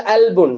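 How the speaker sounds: அல்புன் opening on a vowel, no Q; Egyptian hamza. Shown as a demonstration. Teacher showing how Egyptians say heart, அல்புன் without Q. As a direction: start அல்புன் with a glottal onset. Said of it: "அவங்க எப்படி pronounce பண்ணுவாங்க".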